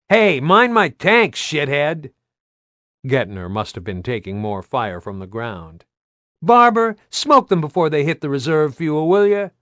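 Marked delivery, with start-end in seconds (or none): 0.1-2.1 high, loud, slow indignant shout; furious pilot shouting over gunfire; angry yell. Acted. Pilot yells under ground fire. 6.4-9.5 high, loud call over the radio; urgent shout. Acted. Pilot calling out over radio.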